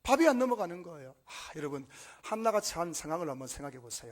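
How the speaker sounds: ordinary talk, nothing marked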